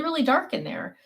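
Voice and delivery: normal talking voice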